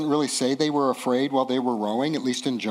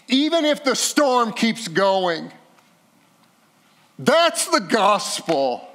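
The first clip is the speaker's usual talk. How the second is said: impassioned preaching; 10 semitones higher, 5 dB louder than usual. Real, not acted. Pastor urging the congregation to trust Jesus in the storm, impassioned preaching.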